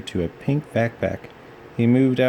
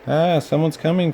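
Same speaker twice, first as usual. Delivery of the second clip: cried out; 8 semitones higher than usual. A narrator's reading. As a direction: call out as the nervous dinosaur. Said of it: "cried Rex".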